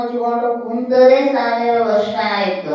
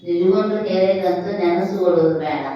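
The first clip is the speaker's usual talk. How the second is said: ordinary talk, nothing marked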